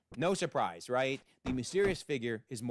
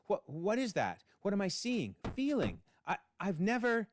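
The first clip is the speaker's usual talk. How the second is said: bewildered; 6 semitones higher than usual. Acted. Cain overwhelmed by emotions.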